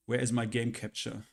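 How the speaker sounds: ordinary talk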